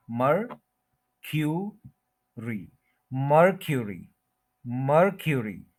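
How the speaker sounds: मरक्यूरी from slow "MUR... kyu... ree" to joined-up; syllable-by-syllable pronunciation. Shown as a demonstration. Teacher drilling the "kyu" sound, slow careful pronunciation. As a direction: spell out three beats of मरक्यूरी, then join them. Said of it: "इसको क्यू बोलेंगे".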